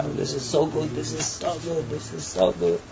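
soft, hushed repeated approval; mock approval. People judging everything around them, murmured mock approval.

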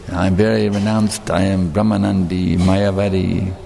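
low, slow, self-important declaration; mock proud renunciant. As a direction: imitate a solemnly self-satisfied renunciant, voice dropped low. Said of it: "he's very proudly saying".

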